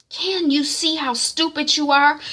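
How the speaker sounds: high, slow scolding mother; mock scolding. Mum scolding Shaliwa over designer bags, high and slow. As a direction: scold her like a fed-up mum.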